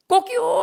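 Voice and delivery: high mock rooster crow. Preacher imitates the rooster crowing, high crow. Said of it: "하고 닭이 우니까".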